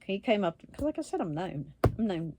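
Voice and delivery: ordinary talk, nothing marked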